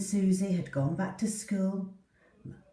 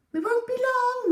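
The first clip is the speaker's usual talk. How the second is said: much higher, called across the house; calling out; 5 dB louder than usual. Acted. Mum calling to Poppy as they leave, loud call. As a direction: call back on the way out the door. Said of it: "Mum called".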